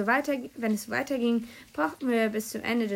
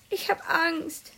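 whimpered higher and slow; a scared child whining slowly; whimpering; 7 semitones higher, slower than usual. A narrator's reading. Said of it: "winselte Mika". (ängstlich wimmernd, höher und langsam)

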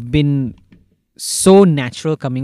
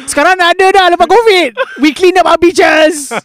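loud mock politician; 10 dB louder than usual. Acting a proud politician.

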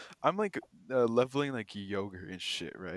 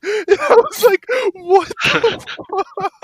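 high, loud shriek of laughter through the words; laughing disbelief; 18 dB louder, slower than usual. Real, not acted. The clerk reacting to the customer, shrieking with laughter. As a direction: crack up in disbelief.

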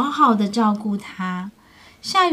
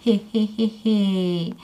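sinister chuckle; 2 semitones lower, 3 dB quieter than usual. Acted. T-rex creeping up.

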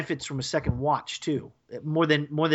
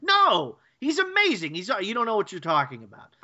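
Jake; 5 semitones higher than usual. Retold or imitated. Retelling a cartoon character defending the conductor, high mock protest. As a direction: imitate an indignant objection, starting very high and easing down. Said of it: "Jake takes immediate protest".

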